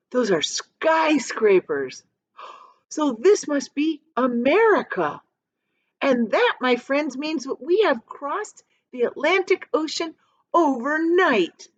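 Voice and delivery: high, eager announcement; excited. A narrator's reading. James excitedly telling friends they reached America. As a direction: declare it thrilled to friends. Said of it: "jumping up and down with excitement".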